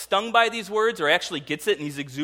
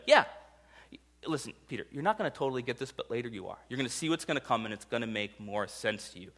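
much softer, calm reassurance; gentle Jesus; 4 semitones lower, 8 dB quieter, faster than usual. Retold or imitated. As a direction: speak softly like a patient teacher. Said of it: "And Jesus says in verse seven".

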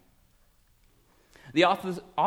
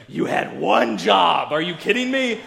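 exasperated scolding; 5 semitones higher, 6 dB louder than usual. Retold or imitated. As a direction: scold them, hammed-up disbelief. Exasperated Pharisees scold the police.

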